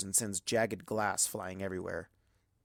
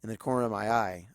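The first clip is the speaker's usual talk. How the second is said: ordinary delivery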